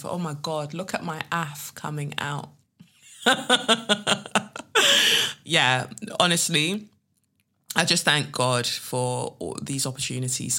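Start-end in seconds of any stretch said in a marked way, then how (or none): 3.2-5.4 loud laughing fit; high burst of laughter. Real, not acted. Host laughs at her slipping accent, loud laughter. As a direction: crack up at yourself.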